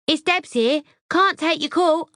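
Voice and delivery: high, bright recorded greeting; tinkly voicemail voice. A narrator's reading. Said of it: "a tinkly voice says".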